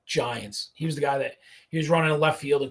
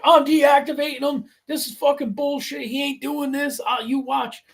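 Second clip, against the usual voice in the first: angry coach; 10 semitones higher than usual. Retold or imitated. Angry coach benching a no-show player.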